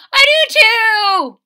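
Lily thrilled they share a cup; squealing girl. Acted. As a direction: squeal it with joy. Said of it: "said Lily".

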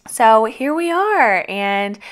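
high, slow sing-song; sing-song drawl. Real, not acted. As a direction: stretch it out playfully. Playful drawn-out aside.

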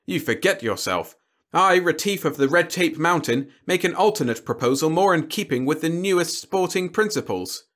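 formal, mildly stern declaration; understated reproof turning to a grand proposal. A narrator's reading. Envoy answering the alien's challenge in kind, mildly stern. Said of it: "said Retief sternly".

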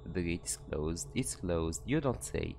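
ordinary delivery

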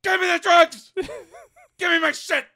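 hammed-up angry yell; mock addict's demand. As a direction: demand it loudly in hammed-up desperation. Drug-crazed Holmes making demands.

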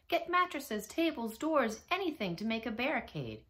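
high, urgent shouted orders; mayor's shout. A narrator's reading. Mayor ordering townsfolk to build a barricade, shouting. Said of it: "shouted the mayor".

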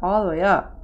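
ordinary delivery

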